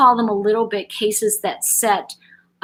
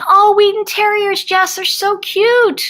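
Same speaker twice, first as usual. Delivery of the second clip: gushing delight; 12 semitones higher, 6 dB louder than usual. Real, not acted. Viewer mentions owning a Wheaten.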